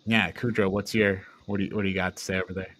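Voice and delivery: ordinary delivery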